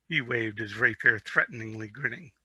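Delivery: plain reading voice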